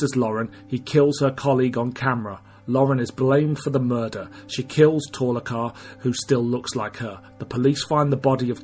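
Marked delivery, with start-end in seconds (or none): none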